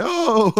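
sudden high whine of need; high mock craving cry. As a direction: yelp like an impatient addict. Mock craving outburst, high pitch.

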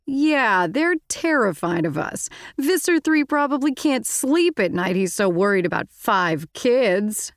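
drip with sarcasm; sarcastic. A narrator's reading. Marco to Rachel, sarcastically mocking her boast. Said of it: "Marco said sarcastically".

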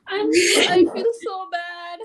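high, slow fake sob; mock crying. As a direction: whine it in fake sympathy. Mock sympathy for a friend.

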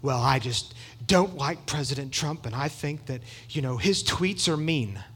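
lightly voiced complainer, low and soft; a hint of a timid grumbler; mock complainer. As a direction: mimic a reluctant objector under your breath. Voicing a complainer about the president.

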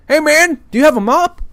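loud, high-pitched imitated holler; calling out. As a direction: imitate a guy hollering across a room.

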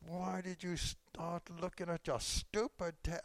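high, slow, drawn-out exasperation; mock-mad inner rant. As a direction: stretch out the angry thought in a high voice. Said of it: "I'm just so mad. I'm thinking".

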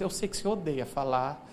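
low, awkwardly tactful mother-in-law; embarrassed. Retold or imitated. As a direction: imitate her uneasy, careful remark. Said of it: "ela falou de um jeito constrangido".

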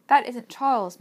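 put-on a girl's loud, insistent outcry; crying out. A narrator's reading. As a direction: cry out in urgent denial. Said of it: "Meg cried".

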